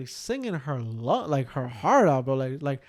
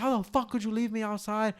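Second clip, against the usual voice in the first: high, softer imitation of screaming; mock outrage; 6 semitones higher, 5 dB quieter than usual. Retelling girlfriend confronting boyfriend, mock outrage. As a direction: mimic her indignant yell, held down.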